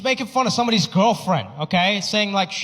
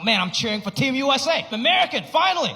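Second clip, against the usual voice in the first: mock proud shout rising higher; excited cheer; 7 semitones higher than usual. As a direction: cheer for the team, proud at last. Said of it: "I was like".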